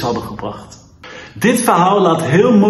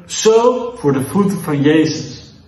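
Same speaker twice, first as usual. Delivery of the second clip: ordinary delivery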